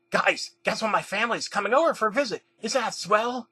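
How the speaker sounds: high, excited character voice; excited exclaiming. A narrator's reading. Rabbit tells friends his family is visiting, excited exclaiming. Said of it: "got a text on his phone and exclaimed".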